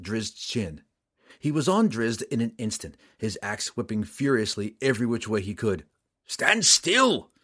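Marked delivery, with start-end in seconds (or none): 6.3-7.3 high, loud frustrated shout. Acted. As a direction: yell it at a dodging foe. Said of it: "Roddy cried".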